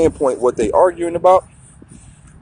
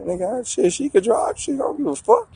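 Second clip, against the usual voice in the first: impressed inner thought; 8 semitones higher, 6 dB quieter than usual. Retold or imitated. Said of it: "'cause I'm like".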